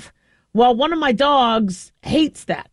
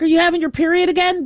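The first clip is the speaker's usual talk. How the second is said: higher, quick startled question; shocked question; 6 semitones higher, faster than usual. Retold or imitated. Mom startled at her bleeding dog, quick shocked question. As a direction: blurt it out in disbelief. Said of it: "And I'm like".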